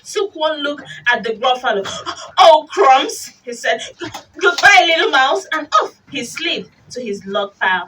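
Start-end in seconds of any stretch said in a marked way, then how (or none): 2.4-3.3 loud, alarmed exclamation; sudden hammed-up dismay; startled snake. Snake frightened by gruffalo. 4.4-5.5 loud, high, hurried goodbye; mock-panicked exit; frightened farewell. Snake flees gruffalo.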